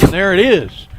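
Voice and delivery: loud excited play-by-play call. Real, not acted. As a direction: burst out as the strikeout lands. Sixth straight strikeout.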